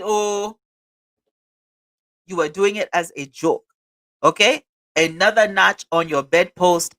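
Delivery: put-on African accent; African cousin's accent. African cousin telling off the president who ran as a joke, African accent. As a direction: scold him like an African cousin. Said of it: "in my African cousin's voice".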